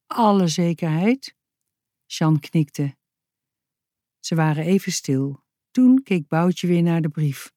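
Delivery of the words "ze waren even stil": usual speaking voice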